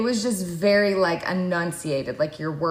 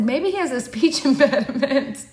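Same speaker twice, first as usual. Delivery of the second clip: laughing quote; 5 semitones higher than usual. Retold or imitated. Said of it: "my mom said".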